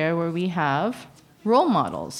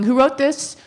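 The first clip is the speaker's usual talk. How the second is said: louder, higher indignant demand; retold confrontation; 6 semitones higher, 4 dB louder than usual. Advocate confronting youth protection over paperwork, indignant and raised. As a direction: demand answers, pitched up and pushy. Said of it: "This is me going to youth protection going".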